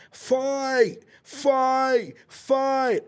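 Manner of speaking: high chanting fight fight fight; retold screaming. The teacher screaming at the fight, high mock yell. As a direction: scream it like a crowd egging on. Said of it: "he starts screaming".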